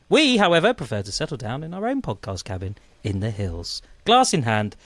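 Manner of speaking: radio show intro. Acted. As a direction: set the scene like a travel documentary narrator. Scripted show intro over music.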